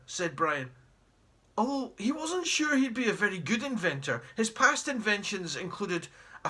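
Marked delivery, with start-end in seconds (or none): none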